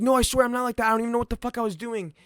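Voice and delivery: high, loud, rushed pleading; hammed-up panicked squeal, words tumbling out; frantic retold excuse. Patient swearing innocence to the nurse, panicked and high.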